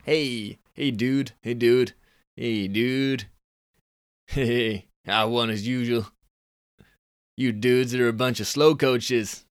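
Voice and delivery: mild Californian dude. Shown as a demonstration. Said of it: "he's a Californian kind of guy".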